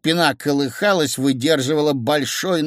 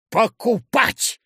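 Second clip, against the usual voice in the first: high, drawn-out exclamation; emphatic decision; 7 semitones higher, 3 dB louder, much slower than usual. A narrator's reading. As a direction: declare it slowly and high, as a verdict. Crowd's sudden decision voiced.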